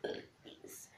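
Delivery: burped word, whispered high; talking while burping. Shown as a demonstration. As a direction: burp out please. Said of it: "That was please".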